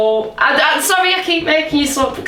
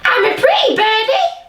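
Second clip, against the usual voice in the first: much higher squawky parrot voice; 10 semitones higher than usual. Pretend. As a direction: parrot it back, high. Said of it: "you talk like a parrot".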